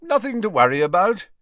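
high, loud, breezy dismissal; airy. A narrator's reading. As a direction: wave the worry away lightly. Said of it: "he said airily".

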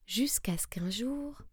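hushed storyteller whisper; whispered suspense. A narrator's reading. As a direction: draw the listener in.

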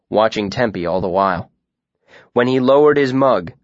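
usual speaking voice

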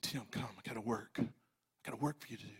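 very soft, low murmur; God calling quietly, barely voiced; hushed divine call. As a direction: drop right down to a private, intimate summons. Voices God's gentle call.

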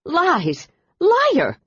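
enraged shout, pitch leaping up; furious outburst. A narrator's reading. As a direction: hurl it out in fury. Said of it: "lunged at Thiael Thas in such fury".

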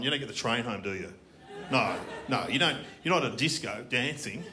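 ordinary talk

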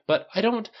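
high-pitched, flustered protest; floundering. A narrator's reading. Son trying to explain himself to his mother, floundering. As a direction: break off high, at a loss for words. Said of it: "Tony floundered".

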